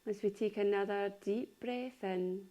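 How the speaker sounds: unhurried yoga nidra voice, long pauses between phrases; calm body-scan delivery, spaced out phrase by phrase; guided meditation. Acted. Leads a guided relaxation.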